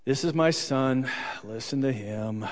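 slow, low, weary sigh; facepalm exasperation. Acted. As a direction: drag it out like a tired, exasperated father. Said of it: "one of those facepalm moments".

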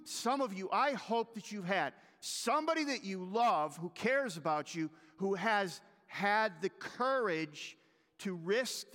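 loud, high, driving sermon delivery; preaching at full force. Acted. Preacher urging the congregation to speak truth, loud and impassioned. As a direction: press it home to the congregation.

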